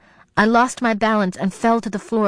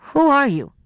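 high, sharp challenge; alarmed mother's pitched-up question; demanding; 7 semitones higher than usual. A narrator's reading. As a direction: demand an answer from a stranger. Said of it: "she demanded of Tony".

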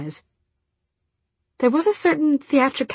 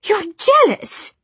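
high, loud, slow outburst; shrill accusation; 8 dB louder, much slower than usual. Acted. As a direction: fling the accusation at her. Jessamine accusing.

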